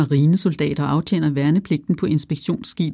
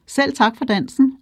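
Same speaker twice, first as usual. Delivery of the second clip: high, ringing sarcastic call after her; booming parting shot; 8 semitones higher than usual. A narrator's reading. Rejected sailor calling after Lisa, mockingly ringing. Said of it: "runger det stadig efter hende".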